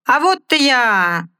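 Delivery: high, slow stage shout again held; loud cry. Acted. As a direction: bellow it to the arena. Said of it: "громко крикнул хозяин".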